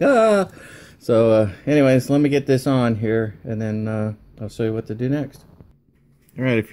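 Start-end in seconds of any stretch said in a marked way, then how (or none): 0.0-0.5 sudden high, loud "Ah!"; startled yelp. Retold or imitated. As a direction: yelp as the part flies off. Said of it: "It was like".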